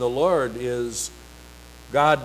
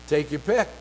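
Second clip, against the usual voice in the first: ordinary talk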